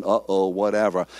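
ordinary delivery

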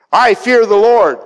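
high, loud pious proclamation; emphatic declaration. Acted. Jonah confessing his religion to the sailors, loudly proclaiming.

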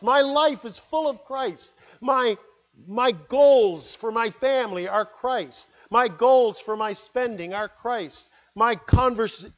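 high, loud, fervent preaching; preacher proclaiming a life full of Christ; preaching at full force. Acted. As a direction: build the sermon to its climax. Preacher builds to climax.

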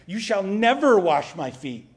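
a hint of loud indignation, stepping back; Peter's proud refusal, lightly voiced; indignant. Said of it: "standing up, stepping back, indignant".

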